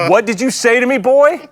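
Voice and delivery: high, loud scolding of his son; hammed-up outraged dad; mock stern parent. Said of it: "I'm like".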